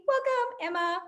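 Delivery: name flung way up high; theatrical welcome. Acted. Host welcoming guest Emma with a theatrical high swoop. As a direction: announce her like a game-show host. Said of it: "after your performance".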